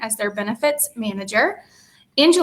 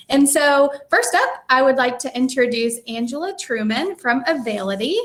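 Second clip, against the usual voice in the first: normal talking voice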